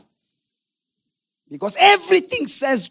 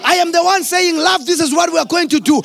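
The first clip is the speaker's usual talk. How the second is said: preaching at full force; 5 semitones higher than usual. Acted. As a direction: build it like a rousing sermon.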